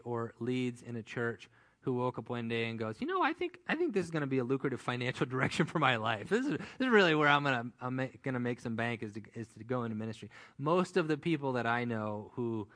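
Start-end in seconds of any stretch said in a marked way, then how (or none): 3.0-10.3 hammed-up money-hungry pastor, laughing at the idea; mock greedy minister. Pastor mocks someone choosing ministry for money, sarcastic.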